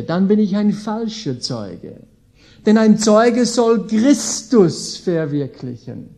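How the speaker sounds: ordinary delivery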